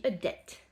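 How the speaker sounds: "debt" said as det; silent B. Shown as a demonstration. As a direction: drop the B in "debt". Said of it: "le B à la fin ne se prononce pas".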